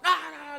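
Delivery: high, loud drawn-out "aah"; mock panicked cry. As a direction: imitate a man freaking out, long and high. Imitates Peter panicking at servant girl. (grito fingido de susto, agudo y alargado)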